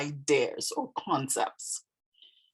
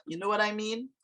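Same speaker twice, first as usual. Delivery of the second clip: "You know what I mean?" higher, rising as a question; questioning rise; 4 semitones higher, faster than usual. Shown as a demonstration. Teacher repeating the example of English question tone, questioning rise. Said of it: "My tone went up".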